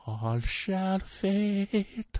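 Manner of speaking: high, very slow Scottish singing; Scottish accent. Retold or imitated. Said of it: "with his really thick Scottish accent".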